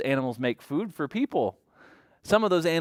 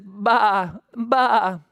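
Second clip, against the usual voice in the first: loud, higher bleating baa; sheep bleat; 8 semitones higher, 5 dB louder than usual. Acted. Giving children a clue to the next animal, sheep bleat. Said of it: "Sheep says".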